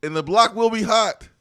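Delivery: very high, loud holler; hyped proclamation. Acted. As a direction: proclaim it like a hype man.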